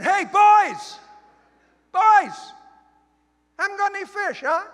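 very high, loud holler; calling out. Acted. Jesus calling boys from the beach, hollering. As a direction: yell it across water to a boat. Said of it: "This is Jesus standing on the beach going".

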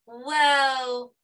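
surprised whoa. Real, not acted. Teacher amazed as colours spread in milk, excited whoa. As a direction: sound truly amazed at the milk.

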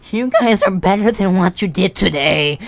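disappointed teacher, mocked; a mild mock lecture to the students; imitating the teacher. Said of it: "She said".

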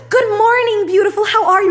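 high, gushing barista greeting; imitated cheery greeting. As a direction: put on a chirpy customer-service voice. Cheery Starbucks worker contrasted with monotone one.

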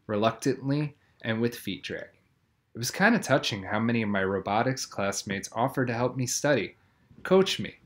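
ordinary delivery, nothing marked

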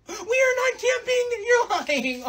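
very high, slow dramatic whine; bratty protest. Acted. Daughter refusing dad's camping trip, whining indignantly. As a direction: wail the refusal like a spoiled daughter.

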